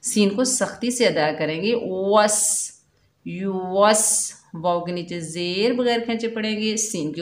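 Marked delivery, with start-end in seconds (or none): 2.1-4.3 वस with fully rounded lips and a hard स; slow careful वस demo; rounded lips, firm s. Shown as a demonstration. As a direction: say यू वस slowly, lips round, स crisp. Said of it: "होंठों को मुकम्मल गोल करेंगे".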